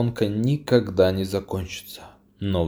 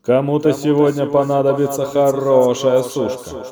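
higher, louder teasing commentator; 6 semitones higher, 5 dB louder than usual. A narrator's reading. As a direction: quip it like a race announcer. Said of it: "сказал комментатор".

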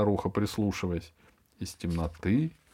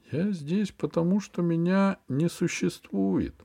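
slow and higher, secretive; mysterious; 8 semitones higher, slower than usual. A narrator's reading. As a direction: say it like a riddle. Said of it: "загадочно ответила старуха".

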